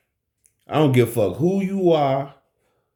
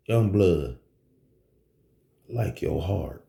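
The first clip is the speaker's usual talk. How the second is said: low dealer voice; 8 semitones lower, 4 dB quieter than usual. Retold or imitated. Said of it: "He said just like that".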